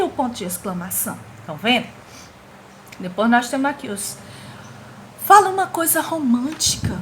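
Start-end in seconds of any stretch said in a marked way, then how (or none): 5.3-7.0 put-on demanding girlfriend. Teacher voicing girl in comic.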